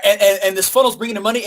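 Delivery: ordinary talk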